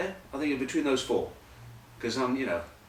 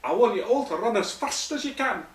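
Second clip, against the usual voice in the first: put-on loud, very high old Scottish coach; Scottish accent; 13 semitones higher, 7 dB louder than usual. Scottish coach orders players to run, loud high Scots. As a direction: bark it out high in exaggerated Scots. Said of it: "the old Scottish voice".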